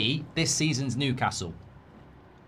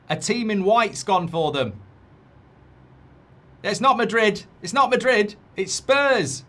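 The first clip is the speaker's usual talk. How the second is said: high, louder fake urgent news; mock alarm; 8 semitones higher, 5 dB louder than usual. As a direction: pretend it's breaking disaster news.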